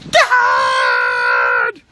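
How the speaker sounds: imitated scream for Dad; loud, drawn-out mock cry for help; Dennis. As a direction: wail it out in one long comic scream. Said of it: "You wanna hear Dennis scream?".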